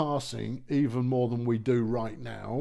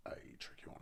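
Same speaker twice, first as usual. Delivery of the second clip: whispering; 23 dB quieter, much faster than usual. Real, not acted. Repeats it to himself.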